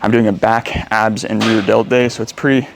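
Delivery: panting between words after a heavy set; out of breath. Real, not acted. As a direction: talk while still out of breath. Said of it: "sorry, I'm out of breath".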